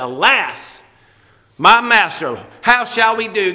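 loud, desperate plea to the master; deer-in-headlights servant crying for help; panicked cry. Retold or imitated. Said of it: "No, he said".

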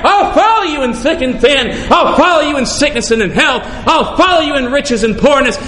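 usual speaking voice

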